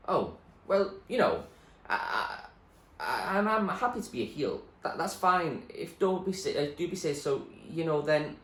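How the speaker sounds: high, stammering, trailing off; nervous girl stammers her answer; flustered girl. Acted. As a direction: stumble and fade out. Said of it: "she trailed off".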